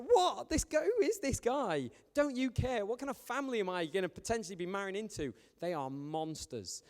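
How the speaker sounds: high, outraged disbelief, settling at the end; indignant shock. Retold or imitated. Andy telling the laughing in-laws, shocked and outraged. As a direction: play Andy baffled by the laughing family. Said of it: "he was like".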